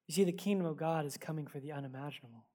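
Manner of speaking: ordinary delivery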